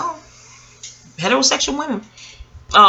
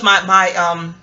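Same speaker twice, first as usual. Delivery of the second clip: ordinary talk, nothing marked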